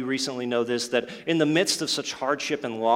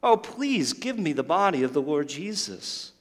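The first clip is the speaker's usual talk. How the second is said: high, imploring voice; pleading; 5 semitones higher than usual. Acted. Mary begging the supposed gardener for the body, pleading. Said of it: "she pleads with him".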